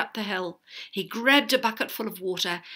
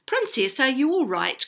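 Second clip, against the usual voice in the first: higher, louder worried call; worried cry; 6 semitones higher, 6 dB louder than usual. A narrator's reading. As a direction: call out in concern. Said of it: "cried one of the men".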